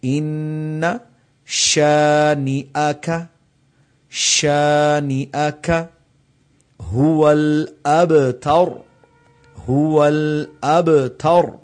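slow chanted recitation with qalqala on ب; tajweed recitation. Shown as a demonstration. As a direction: recite like Quran, bounce the ب. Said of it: "با کے اوپر قلقلہ آیا ہے".

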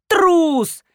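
high, drawn cry of contempt; indignant accusation. A narrator's reading. Old woman scolding the cowardly grandpa, indignant accusation. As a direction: throw it out as an accusation. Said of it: "топнула ногой третья старуха".

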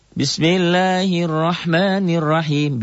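slow, high melodic tartil chant; Quran recitation. Shown as a demonstration. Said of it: "teacher recites basmala". (lantunan tartil pelan)

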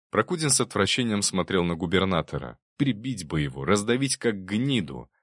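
plain reading voice

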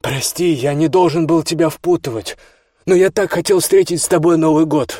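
high, loud, building plea. A narrator's reading. David says goodbye beside roaring helicopter, calling out. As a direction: apologise over the noise, voice climbing. Farewell shouted over rotor noise.

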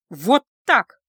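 loud, emphatic retort; cutting in. A narrator's reading. Daughter interrupting her mother, loud and emphatic. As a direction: interrupt forcefully, two punched words. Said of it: "перебила в очередной раз Аня".

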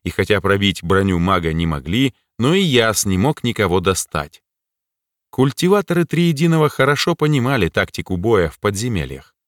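plain reading voice

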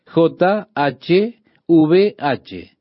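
very slow, letter by letter; deliberate one-letter-at-a-time spelling; spelled out. Shown as a demonstration. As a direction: spell J H V H with a long pause between letters. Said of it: "este nombre quedaría así".

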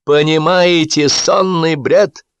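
high, very drawn-out scolding; exasperated ranting. A narrator's reading. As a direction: stretch the words in theatrical despair. Director keeps berating his actor.

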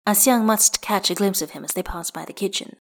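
usual speaking voice

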